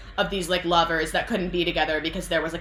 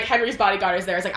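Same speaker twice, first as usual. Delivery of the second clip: ordinary talk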